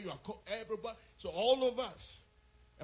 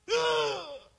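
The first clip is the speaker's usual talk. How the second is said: big loud high sigh of despair; loud sigh of a frustrated father; exasperated sigh; 11 semitones higher, 10 dB louder than usual. Retold or imitated. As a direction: let out a heavy fed-up sigh. Son refuses to leave friends.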